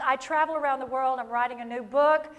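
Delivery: usual speaking voice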